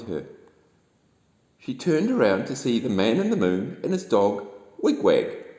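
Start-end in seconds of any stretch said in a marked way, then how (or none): none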